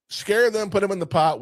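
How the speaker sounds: loud eager ogre wife; excited shout. Retold or imitated. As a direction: bark out the plan eagerly.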